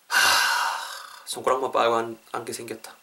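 low, soft grumble after a big sigh; sigh, then lament under your breath; dejected sigh. Acted. Acted sighing over money trouble. (한숨 쉬며 풀 죽은 목소리로)

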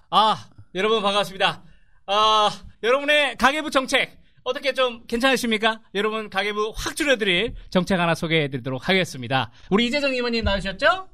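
high, upbeat sales pitch; home shopping host. Shown as a demonstration. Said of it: "대략 생각나는 거 한번 해보겠습니다".

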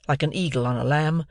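ordinary delivery, nothing marked

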